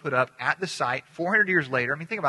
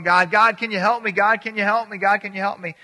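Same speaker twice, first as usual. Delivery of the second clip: mock plea, raised and louder; hammed-up pleading, higher voice; pleading for help; 5 semitones higher, 6 dB louder than usual. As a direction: act out a desperate call for help. Cliff hanger pleads for help.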